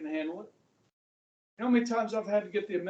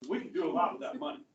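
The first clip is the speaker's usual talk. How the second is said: fast first pass, a touch offhand; quick throwaway; 4 semitones lower, faster than usual. Acted. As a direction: rush it lightly before the big repeat. Repeats the line for effect.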